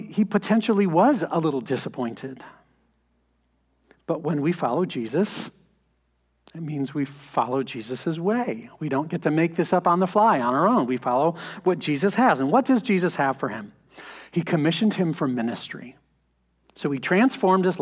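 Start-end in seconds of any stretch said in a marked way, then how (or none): none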